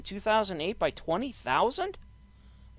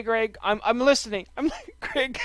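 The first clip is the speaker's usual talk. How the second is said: usual speaking voice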